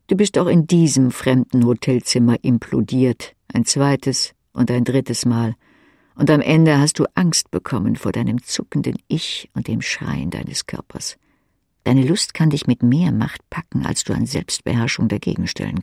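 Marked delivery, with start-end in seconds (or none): none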